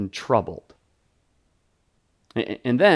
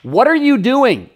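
higher, louder frustrated outburst at Jesus; exasperated plea; 10 semitones higher, 6 dB louder than usual. Retold or imitated. Onlookers urge weeping Jesus to act, in an exasperated near-shout. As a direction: demand that he stop crying, half shouting. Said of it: "It's like".

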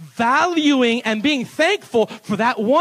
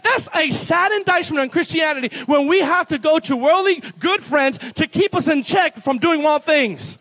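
ordinary talk

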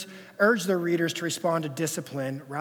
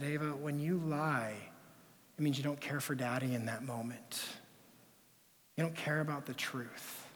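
put-on softer, down at a child's level; tender correction; gentle fatherly talk; 8 dB quieter than usual. Father kneels to daughter's level.

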